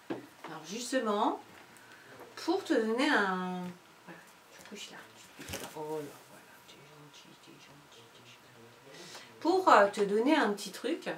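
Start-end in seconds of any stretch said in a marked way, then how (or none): 4.1-8.4 soft, near-whispered coaxing; hushed to the dog. Real, not acted. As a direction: settle the dog down gently. Soothing the dog mid-lesson.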